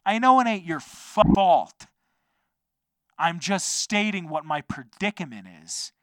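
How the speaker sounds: loud, high-pitched exasperated retort; on edge completely. Retold or imitated. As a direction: snap back loudly, at the end of your patience. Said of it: "on edge completely".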